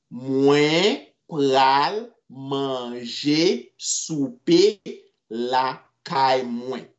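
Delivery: very slow, stretched words; slow teaching repeat. Shown as a demonstration. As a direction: spell out the sentence slowly for learners.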